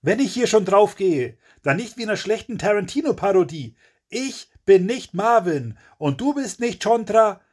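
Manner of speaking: high, heated tirade; furious rant. A narrator's reading. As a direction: keep ranting at full indignation. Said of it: "brüllt Kevin wie verrückt los".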